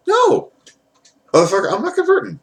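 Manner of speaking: very high squeaky protest; hammed-up falsetto objection; mock outraged refusal. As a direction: imitate a husband refusing in a shrieky high pitch. Voices husband refusing to convert.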